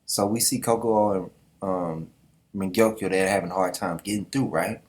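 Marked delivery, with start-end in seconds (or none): none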